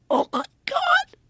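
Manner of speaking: very high, slow mimicked gasp of disbelief; imitated awe and fright. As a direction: draw it out high, as a stunned cameraman. Retold cameraman seeing the disc.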